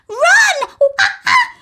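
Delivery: very high, loud screeching alarm; monkey shrieking at the children to run; frantic monkey shout. Acted. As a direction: screech at them to flee. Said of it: "Mugglewump shouted to the children".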